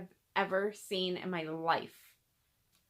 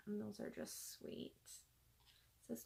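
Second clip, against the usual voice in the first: hushed whisper; 18 dB quieter, slower than usual. Real, not acted. Vlogger showing ornaments from friend Lisa, hushed whisper. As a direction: drop to a near-silent whisper.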